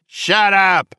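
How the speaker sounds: high furious scream. A narrator's reading. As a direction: scream her mother into silence. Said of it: "Anne screamed at her".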